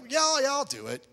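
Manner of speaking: high, hurried-sounding imitated yes; mock breezy agreement. Unconvinced lady agreeing to pray, mock breezy.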